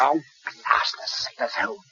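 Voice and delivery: muttered curse. Acted.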